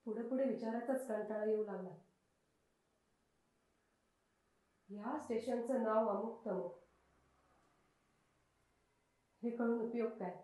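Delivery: weary, drowsy. Acted. Woman recalls tiring of questions and dozing, drowsy. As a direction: let it fade, slowing into a drowsy hush.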